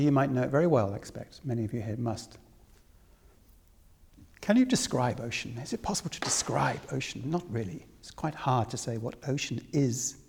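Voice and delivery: ordinary delivery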